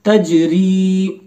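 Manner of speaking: तजरी read slowly, र joined to ई; drawn-out teacher reading of तजरी; slow tutor reading; blended syllables. Shown as a demonstration. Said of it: "रा से या को मिलाएंगे".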